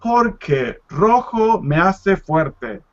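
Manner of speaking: slow, careful "Porque rojo me hace fuerte"; slow teacher model sentence; slow model answer. Shown as a demonstration. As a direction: spell it out slowly for the learners. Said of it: "The answer is".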